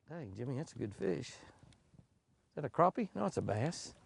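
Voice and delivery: normal talking voice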